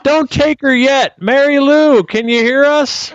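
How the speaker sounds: put-on high, loud, urgent old-timer voice; excited elderly ghost hunter rushing; frantic old investigator. Old investigator calling to the dead.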